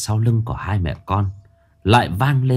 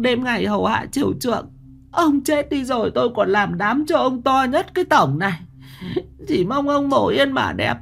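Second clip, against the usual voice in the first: pleading prayer. Acted. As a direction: plead with the dead husband's spirit. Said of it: "Mụ Mão chắp tay".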